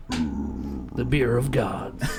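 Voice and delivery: low, slow announcer tagline; deep tagline voice. Pretend. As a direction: intone it like a trailer. Jokey tagline after ad.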